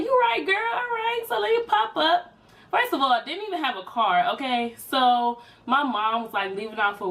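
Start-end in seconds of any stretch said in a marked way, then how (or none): 0.0-2.3 much higher, bright bubbly reply; high excited agreeing voice; high girly voice. Retold or imitated. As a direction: squeal it out like an eager girl. Quoting her own upbeat reply.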